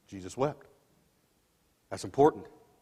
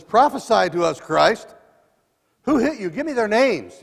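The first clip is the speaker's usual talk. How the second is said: loud jeering taunt, higher; mocking taunt; 8 semitones higher, 9 dB louder than usual. Soldier taunts the blindfolded Jesus, loud mocking jeer. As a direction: sneer it at the prisoner.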